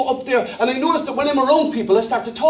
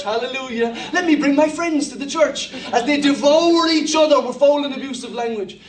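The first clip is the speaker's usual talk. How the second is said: ordinary talk, nothing marked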